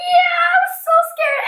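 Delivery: very high mock-tearful whine; hammed-up crybaby wail; scared child whining. Scared girl answering her dad, whining tearfully. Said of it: "And I was like".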